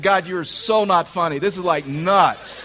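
loud, high exasperated complaint; mock mad. Husband complaining to God as phone rings, mock mad. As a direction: protest loudly, stretching the last word. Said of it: "I'm really mad at God".

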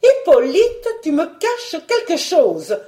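high, stern accusation; worked-up, reproachful wife; severely articulated. Acted. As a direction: confront him severely, loud on the name. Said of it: "Eudoxie sévèrement articulait".

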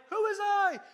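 high, loud mock yell; hammed-up high-pitched retort; screamed back. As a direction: shriek it back in comic bafflement. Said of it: "the disciple who screamed back".

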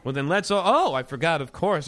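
usual speaking voice

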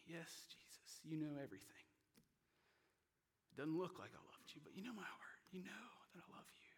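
soft, understated broken plea; falling apart. Acted. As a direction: plead quietly, fading toward a whisper. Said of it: "Peter just falls apart".

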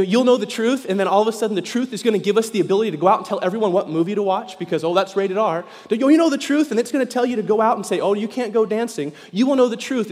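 loud, high preaching build. Acted. Pastor mocking legalistic believers, loud high preaching. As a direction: drive it home with rising fire.

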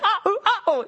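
high laughing uh-oh; caught-out comic panic; mock alarm. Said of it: "you're just like".

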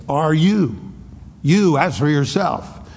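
YOU stressed hard and high; emphatic you. Shown as a demonstration. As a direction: put the weight on you. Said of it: "It's like".

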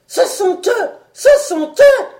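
shouted high and loud, building; roaring cry. Acted. The doctor sees the sea-men, a rising panicked roar. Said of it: "rugit-il".

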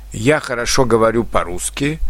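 normal talking voice

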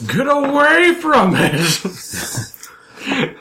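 high, drawn-out panicked scream; hammed-up shriek, far above normal pitch. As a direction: scream it out in character. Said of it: "And I scream".

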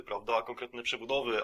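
ordinary talk, nothing marked

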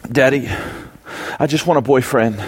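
understated imitation of a small daughter; lightly voiced child's small confession; confiding little girl. Four-year-old daughter confessing to her dad, lightly voiced.

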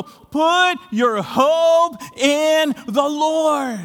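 stretched, ringing exhortation; preaching shout. Real, not acted. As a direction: call it out like a command from the pulpit.

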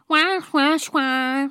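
mimicking gibberish speech; foreign babble. Narrator mimics the new boy's babble. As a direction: babble it like an unknown language. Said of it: "Ça faisait".

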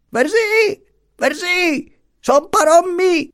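very high, insistent calls; calling out. A narrator's reading. Little master commands stubborn Marianna, calling out. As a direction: order the servant in a piercing high voice. Master orders unwilling servant to open.

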